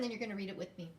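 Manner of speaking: ordinary delivery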